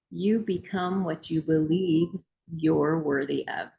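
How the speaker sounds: ordinary talk, nothing marked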